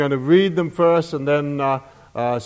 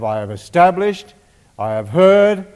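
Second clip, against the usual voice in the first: "I have" pushed out louder each time; emphasis on I have; 2 semitones higher, 5 dB louder than usual. Shown as a demonstration. Preacher underlining God's "I have" for the congregation, louder. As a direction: lean on "I have" as you list them. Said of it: "So we have three emphases on".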